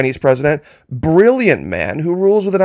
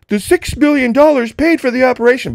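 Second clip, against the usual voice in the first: sarcastic quote pitched much higher; mocking imitation; 10 semitones higher than usual. Mocking the Trumpanzees' first talking point in a high sneer. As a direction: hold the claim up to ridicule. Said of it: "First it was".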